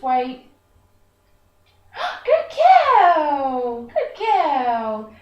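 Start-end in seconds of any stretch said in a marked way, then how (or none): 1.9-5.1 gasping, higher, much louder praise; excited praise. Real, not acted. Trainer rewarding her dog, thrilled and louder. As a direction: burst out with delight for the dog.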